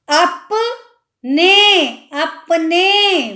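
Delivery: अपने drawn out, very slowly; slow sounding out. Shown as a demonstration. Teacher sounding out अपने. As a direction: say अपने syllable by syllable.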